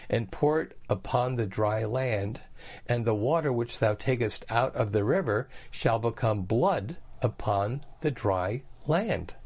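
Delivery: usual speaking voice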